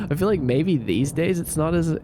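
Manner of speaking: ordinary delivery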